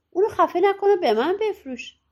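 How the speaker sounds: high-pitched, mildly pleading voice. A narrator's reading. Boy begging farmer to spare the cat, pleading. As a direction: beg for a life in a storyteller's voice. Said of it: "با التماس گفت".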